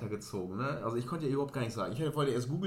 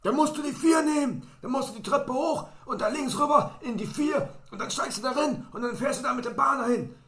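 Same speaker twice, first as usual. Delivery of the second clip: put-on much higher and louder, drunk stranger explaining; hammed-up tipsy woman blurting directions; a loud boozy know-it-all, pitched way up; 12 semitones higher, 7 dB louder than usual. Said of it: "andere Frau mit einer Dose".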